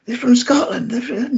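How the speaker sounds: squeaky impression of a friend swearing they're Scottish; mimicking Sean. Retelling how Sean insisted the hosts were Scottish, in a high mock voice. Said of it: "He was".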